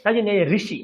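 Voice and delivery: usual speaking voice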